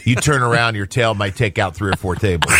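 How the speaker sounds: ordinary talk, nothing marked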